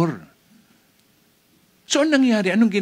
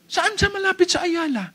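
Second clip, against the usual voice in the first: much higher, pestering question; nosy questioner; 13 semitones higher than usual. Acted. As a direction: keep probing, pitched right up. Acting a persistent questioner. (mataas na boses, makulit na pag-uusisa)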